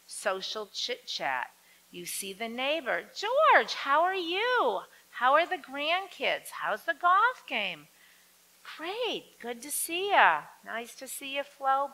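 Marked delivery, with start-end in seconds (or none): 3.1-7.9 cheery greeting. Retold or imitated. Greeting the neighbor, chit chat. 8.8-11.9 cheery reply. Retold or imitated. Neighbor's hearty reply.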